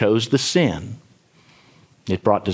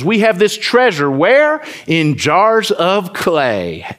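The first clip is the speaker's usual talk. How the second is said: slow, lifted, stressing where and jars; dramatic scripture quote; 7 semitones higher, much slower than usual. Shown as a demonstration. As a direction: draw the verse out slowly for effect.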